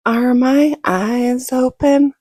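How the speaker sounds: loud, high, drawn-out alarmed question. Retold or imitated. Crash victim asks uncle as everything is dark, alarmed. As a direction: stretch each word out in retold alarm. Sudden blindness after a crash.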